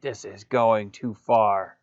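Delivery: slow, drawn-out mock indignation; put-on aggrieved voice, deliberate and slow; upset character. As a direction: play him upset, dragging out each word. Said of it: "He's so upset".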